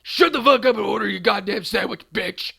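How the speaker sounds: loud, rough abusive tirade; hammed-up furious sandwich-guy rant; nasty yelling. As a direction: scream at the customer like a nasty little monster. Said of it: "a really nasty little monster".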